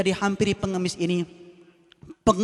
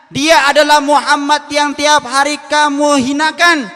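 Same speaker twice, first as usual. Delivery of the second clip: higher, louder and slow, each word weighted; slow emphatic reveal; 9 semitones higher, 10 dB louder, slower than usual. Acted. Abu Bakar repeats the reveal to the beggar, slow and forceful. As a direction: hammer the repeated line home slowly.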